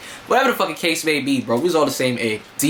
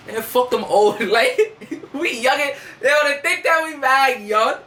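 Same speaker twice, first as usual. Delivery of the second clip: high, laughing brush-off; laughing dismissal; 10 semitones higher than usual. Retold or imitated. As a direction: toss it off with a cocky kid's laugh. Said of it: "Z brushing off older guys".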